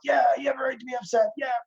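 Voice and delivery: high, fast, shouted cheerleader hype; mock hype. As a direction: pump a friend up, over the top and fast. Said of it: "pumping them up".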